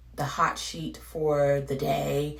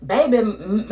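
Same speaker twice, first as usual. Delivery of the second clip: ordinary talk, nothing marked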